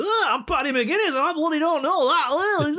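very high, loud caricature; hammed-up bellowing TV-comic shtick; Paddy McGuinness. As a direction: yell it in a squealing mock celebrity voice. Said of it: "the whole Paddy McGuinness shtick".

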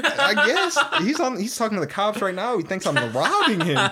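high, laughing through the words; giggling while joking. Real, not acted. Joking to his friend about the kid, laughing. Laughing mock of the kid's dad.

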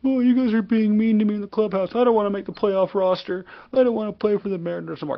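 high whining crybaby voice; whiny baby voice. Retold or imitated. Mocking a departed player as a whiny crybaby. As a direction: whine it like a sulking child. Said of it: "was a little baby back bitch and said".

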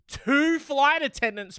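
rising, incredulous stress on "flight". Real, not acted. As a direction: say "two flight attendants" in disbelief, pitched well up. Incredulous aside.

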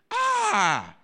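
loud, high wail of hurt; anguished wail. Acted. Esau learning his blessing is gone, anguished wail. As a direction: cry out "ah" in anguish. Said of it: "The Bible says he wept".

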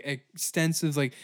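ordinary delivery

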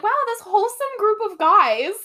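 high-pitched mock admiration with a laugh; gushing. Host recalls her reaction to the movie boys, high amused gushing. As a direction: gush it high, half-laughing. Quotes own reaction to film version.

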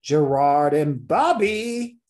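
drawn-out, slow phone-message mimicry; slow, emphatic impression of a caller; imitating the caller. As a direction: stretch out the names like the caller. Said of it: "imitating the person".

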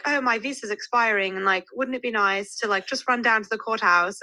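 attempted British accent. British date hinting at a visa marriage, attempted British accent. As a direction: have a go at a British man's accent. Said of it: "try to do a British accent".

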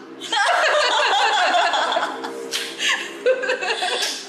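big high burst of laughter; loud laugh. Real, not acted. Long laugh after pun.

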